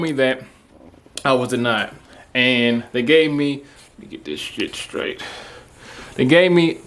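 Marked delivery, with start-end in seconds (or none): 4.0-5.2 whispered aside. Real, not acted. Fumbling with his new camera, whispered aside to himself. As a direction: whisper to himself, low and soft.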